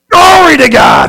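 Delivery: high, very loud shout of praise. Real, not acted. Preacher praising God, loud high shout. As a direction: belt it out in sudden praise. Sudden praise exclamation in church.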